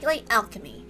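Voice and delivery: ordinary delivery, nothing marked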